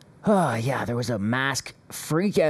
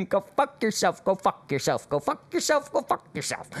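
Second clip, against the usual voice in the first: laughing, lightly mimicking the woman's chant; a hint of the shrill protester; mock Karen repeat; 5 semitones higher than usual. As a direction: parrot her line through giggles. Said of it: "she's having a real bad day".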